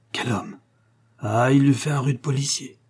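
a mild rueful sigh of admiration; soupir. A narrator's reading. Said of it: "soupira James Smallscott". (soupir admiratif, d'abord doux)

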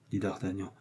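plain reading voice

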